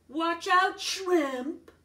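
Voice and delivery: loud, high sneering taunt; loud mocking bully voice. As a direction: jeer at the little kid.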